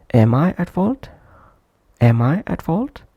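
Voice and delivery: "Am" said fully and strongly, question rising on "fault"; prominent full-form "am". Shown as a demonstration. Teacher demonstrating the full-length form, "Am" pronounced prominently. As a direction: give "Am" its whole vowel, then lift at "fault". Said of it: "that's why pronounced prominently".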